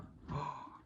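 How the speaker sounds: theatrical gasp of surprise; mock gasp. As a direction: gasp at a big reveal. Mock suspense at the reveal.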